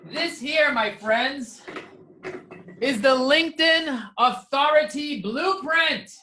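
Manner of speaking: grand announcer pitch. Acted. Presenting his blueprint to viewers, a showman's pitch. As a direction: unveil it like a game-show prize.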